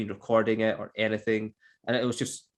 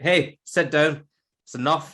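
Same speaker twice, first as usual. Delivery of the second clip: put-on louder, slow telling-off of a child; mock stern scolding; 4 semitones higher, 6 dB louder, slower than usual. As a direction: act out disciplining someone's kid firmly. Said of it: "I don't wanna discipline your kid".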